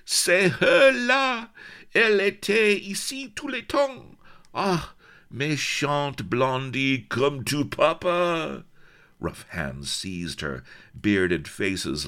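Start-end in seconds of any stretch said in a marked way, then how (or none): 0.0-8.6 high, loud French pirate cries; leering, triumphant holler; triumphant whoops. A narrator's reading. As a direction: whoop slowly and gleefully in French accent. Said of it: "with triumphant whoops".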